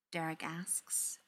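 ordinary delivery

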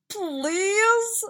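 high, loud, drawn-out please; pleading. A narrator's reading. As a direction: whine a plea to your mom. Son interrupts, pleading with mother.

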